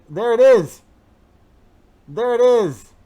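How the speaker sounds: high, loud delight; excited. Real, not acted. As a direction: cheer as it appears. Graphic finally appears.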